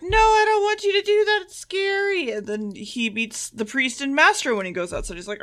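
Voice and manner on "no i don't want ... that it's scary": high, whiny plea to stay; mock scared companion. Companion Joe begging the Doctor not to go, high and whiny. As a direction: squeak it out like a frightened sidekick.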